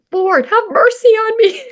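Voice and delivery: high, loud mock wail; retold angry cry. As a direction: cry out in hammed-up desperation. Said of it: "And I was angry".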